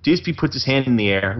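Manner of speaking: ordinary talk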